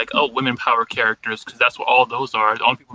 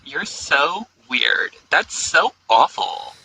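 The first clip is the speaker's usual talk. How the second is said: put-on slow, drawn-out Valley Girl whine; 6 semitones higher, much slower than usual. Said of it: "What are Valley Girl insults?".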